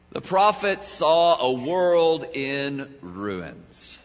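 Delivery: ordinary delivery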